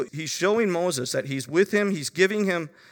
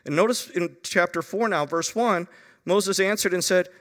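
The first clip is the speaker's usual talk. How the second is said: usual speaking voice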